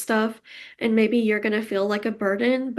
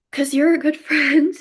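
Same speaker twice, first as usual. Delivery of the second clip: higher, louder, laughing through tears; teary laugh; 5 semitones higher, 6 dB louder than usual. Real, not acted. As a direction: laugh it out while close to crying.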